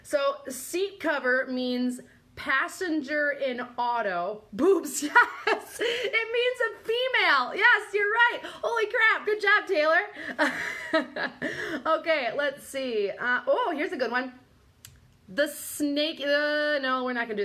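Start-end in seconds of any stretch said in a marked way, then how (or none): 4.5-11.3 high, louder burst of delighted laughter; excited, laughing congratulations; laughing excitement. Real, not acted. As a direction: cheer the right guess through laughter. Viewer guesses the answer, she laughs.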